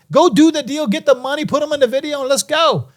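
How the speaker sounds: pumped up. Retold or imitated. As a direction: hype them into closing the deal. Urging the deal through.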